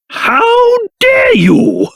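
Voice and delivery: high, slow outraged cry; indignant outburst. Acted. King answers an insult, highly indignant. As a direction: draw it out in affronted outrage.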